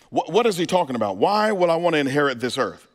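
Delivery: normal talking voice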